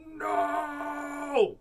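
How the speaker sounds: long, high loud yell; all yelling. A narrator's reading. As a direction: yell it out, drawn out to the end. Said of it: "Julie all yelled".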